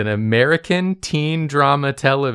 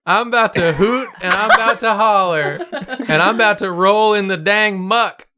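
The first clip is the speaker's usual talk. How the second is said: hillbilly voice; 8 semitones higher than usual. Acted. Hog wild cowboy banter.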